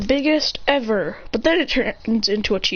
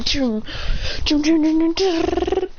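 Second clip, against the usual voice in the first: kunai swoosh noises; 6 semitones higher, slower than usual. Retold or imitated. Imitates kunai and fire sounds.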